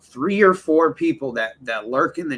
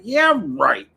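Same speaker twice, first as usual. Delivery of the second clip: sarcastic disbelief; 8 semitones higher, slower than usual. Retold or imitated. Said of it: "I'm like".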